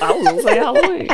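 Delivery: laughing. Real, not acted. Laughing at own wrong guess.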